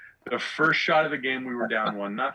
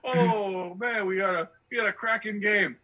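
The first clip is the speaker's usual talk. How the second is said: mocking fake Australian accent, pitched much higher; bad Australian accent; 10 semitones higher than usual. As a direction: ham up a bogus Aussie accent to tease a friend. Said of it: "bullshit Australian accent".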